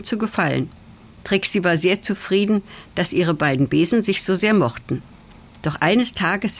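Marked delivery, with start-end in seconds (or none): none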